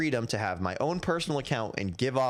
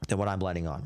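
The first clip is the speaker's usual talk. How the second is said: voice crack; 6 semitones lower, faster than usual. Real, not acted. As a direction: voice cracks mid-phrase. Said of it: "Voice crack".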